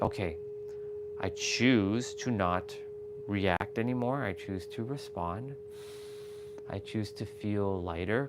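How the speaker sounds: soft, slow, calming inner voice; calm self-talk. Retold or imitated. Soothing himself after emotions take over, soft and slow. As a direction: talk yourself down gently. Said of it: "you start to, like".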